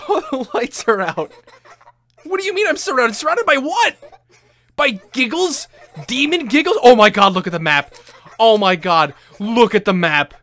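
high, loud, alarmed with nervous laughter; freaked-out, half-laughing panic; panicked. Real, not acted. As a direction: yell in mounting alarm. Surrounded by giggling demons, panicking.

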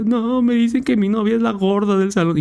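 voz mucho más aguda de niño quejica; suplícale a papá como niño; niño quejumbroso. Retold or imitated. (niño chillón hablándole a su papá)

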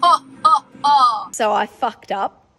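ordinary talk

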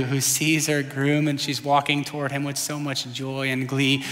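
low, soft and hushed; tender reflection. Real, not acted. Preacher picturing a bride and groom for the congregation, tender and hushed.

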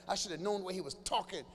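normal talking voice

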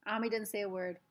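ordinary delivery, nothing marked

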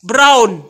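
very loud, clear "Brown"; insistent teaching "Brown", loud and falling; corrective pronunciation model. Shown as a demonstration. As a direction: drill "Brown" emphatically, br not gr. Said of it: "Say brown".

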